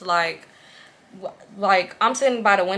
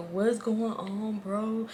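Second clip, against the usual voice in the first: just woke up; 10 dB quieter, much slower than usual. Retold or imitated. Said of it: "Lean ended up waking up".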